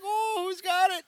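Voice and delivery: very high-pitched mimicking squeal; mock imitation. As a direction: mimic your friend in a tiny high voice. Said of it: "and you were like".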